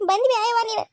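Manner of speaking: high weeping wail; crying lament. Acted. Mother greets her daughter, crying and high. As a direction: weep the words out over her.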